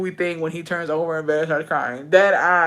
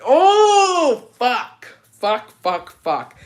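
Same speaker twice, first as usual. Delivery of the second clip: mock moaning; 6 semitones higher, 5 dB louder, much slower than usual. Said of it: "she was just there like".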